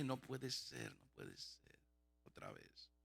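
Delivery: retold dismay, dropping away to almost nothing; hushed disbelief. Man to himself as cough returns, hushed dismay. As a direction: sink to a low, barely audible murmur.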